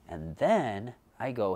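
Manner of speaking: usual speaking voice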